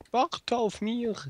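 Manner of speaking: high, slow goofy voice; sing-song it like a child; silly voice. Pretend. Playful call, odd grammar. (alberne Kinderstimme)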